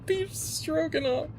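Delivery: wheezing through laughter, slow; laughing. Real, not acted. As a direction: gasp the words between laughs.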